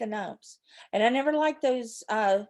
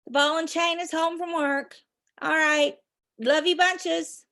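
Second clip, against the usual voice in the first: ordinary talk, nothing marked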